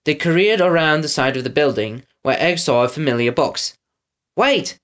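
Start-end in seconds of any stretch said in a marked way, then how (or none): 4.4-4.8 high, sudden cry; cried out. Acted. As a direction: cry out to stop the driver. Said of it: "he cried".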